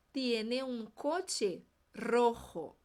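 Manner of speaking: very slow, word by word; "rojo" with strong R and throat J; very slow drill. Shown as a demonstration. Teacher slowing right down. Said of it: "vamos repetir".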